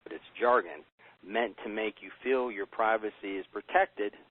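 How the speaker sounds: ordinary talk, nothing marked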